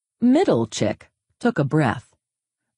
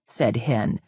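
ordinary delivery

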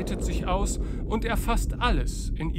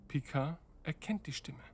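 normal talking voice

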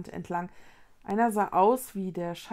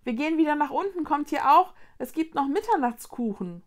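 high, raised call across the roof; calling from afar; 7 semitones higher, 4 dB louder than usual. Acted. Mother calling the three children back downstairs. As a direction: call the kids over from a distance. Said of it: "Etwas entfernt rief Vickys Mutter".

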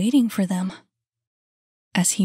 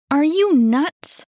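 high, loud and drawn out slowly; incredulous exclamation; 8 semitones higher, 8 dB louder than usual. A narrator's reading. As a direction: exclaim at a crazy idea. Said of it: "she exclaimed".

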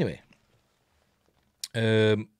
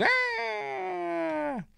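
high held imitated shriek; high hammed-up scream; mock scream; 4 dB quieter than usual. Chestburster moment, imitated scream.

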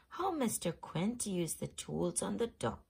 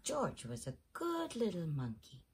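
ordinary delivery, nothing marked

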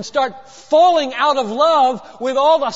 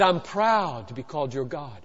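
low, soft statement of pride; hushed, deep voice of God; understated declaration; 9 semitones lower, 8 dB quieter than usual. Acted. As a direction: say it quietly, pitched well down. First take, God's words, understated.

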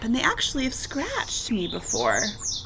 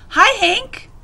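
high, louder friendly hail; calling out; 10 semitones higher, 7 dB louder than usual. A narrator's reading. Rosie the tumbleweed greeting Hank, calling out. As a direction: call out a greeting to a neighbour. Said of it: "Rosie the tumbleweed called out".

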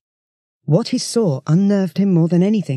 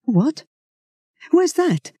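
high, shocked disbelief; a touch of horror, pitched up; aghast question; horrified; 11 semitones higher, much slower than usual. Acted. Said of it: "Duncan was horrified".

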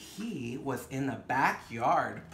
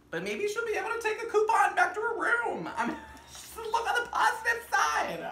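much higher and fast, laughing through it; giggly excited aside; 7 dB louder than usual. Real, not acted. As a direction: bubble over with silly optimism.

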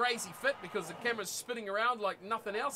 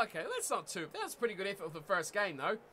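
ordinary delivery

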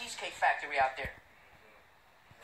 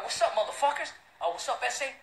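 mock challenge; 5 semitones higher, 4 dB louder than usual. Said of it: "I was like".

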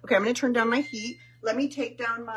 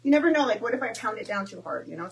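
usual speaking voice

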